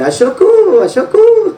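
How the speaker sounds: high squawking parrot cry; parrot call. Retold or imitated. Preacher mimicking the parrot's call to the congregation, high. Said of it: "అది అరిసేది".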